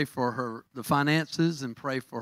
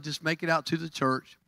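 normal talking voice

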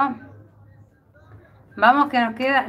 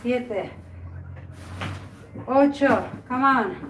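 ordinary talk

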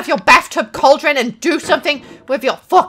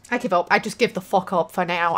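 ordinary delivery

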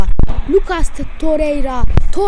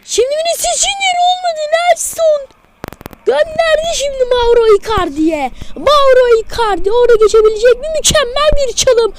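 high, rising attack call; excited commentator; 5 semitones higher than usual. Acted. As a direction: build the call up as he runs. (heyecanlı futbol spikeri)

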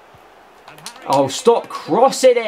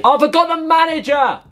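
sudden realisation; 9 semitones higher than usual. Real, not acted. Suddenly remembers the manager.

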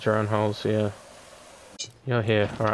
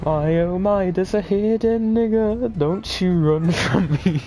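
put-on taunting voice; 10 semitones higher, slower than usual.

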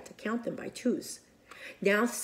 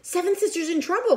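alarmed cry; 9 semitones higher, 4 dB louder, faster than usual. Acted. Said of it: "cried first sister".

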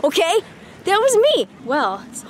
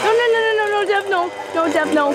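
panicked pleading; 7 semitones higher than usual. Real, not acted. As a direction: beg him to stop in panic. Hearing a chainsaw.

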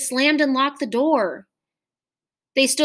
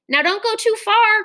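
much higher, louder call; calling after them; 11 semitones higher, 4 dB louder than usual. A narrator's reading. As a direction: send the call after the running children. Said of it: "called the teacher after them".

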